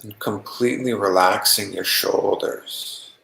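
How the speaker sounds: calm, even meditation voice with long pauses; unhurried, settled meditation guiding; guided meditation. Acted. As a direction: guide them gently into relaxation, leaving long silences.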